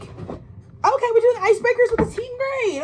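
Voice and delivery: high chirpy mock enthusiasm; mock theatrical thespian. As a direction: play the eager theatre kid at a team icebreaker. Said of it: "you're just like".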